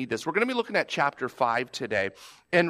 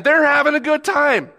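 excited exclamation; 10 semitones higher, 6 dB louder than usual. Real, not acted. Kids enjoying the downpour.

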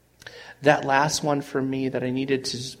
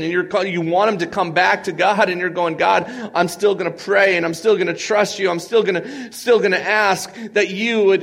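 louder, higher, earnest pleading prayer; preaching a parent's desperate prayer; impassioned prayer; 5 semitones higher, 3 dB louder than usual. Acted. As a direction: plead with God, building.

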